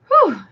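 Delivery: high, loud exhale after a laughing fit; relieved whew after laughter; breathy whew after laughing. Real, not acted. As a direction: blow out a breath, still giggling. Catching breath after laughing hard.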